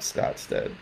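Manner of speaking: Scott's dead breathed out as a stunned whisper; barely voicing Scott's dead in disbelief. Disbelieving echo.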